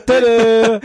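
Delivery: high, loud showman flourish; triumphant tada. Pretend. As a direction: present it like a magic trick. Presenting a joke punchline.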